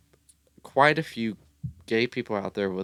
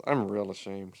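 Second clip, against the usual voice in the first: usual speaking voice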